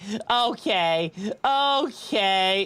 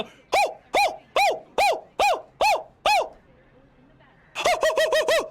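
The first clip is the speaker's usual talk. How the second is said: very high repeated hoo calls to rile the dog; high hooting call. Acted. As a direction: hoot up high like calling a puppy. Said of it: "if I go".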